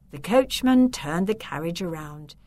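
plain reading voice

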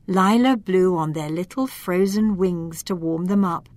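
plain reading voice

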